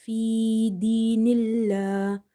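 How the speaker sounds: sustained, level tone with stretched vowels; recitation demo. Shown as a demonstration. Teacher modelling the verse for learners, sustained recitation. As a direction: recite it evenly, drawing out long sounds.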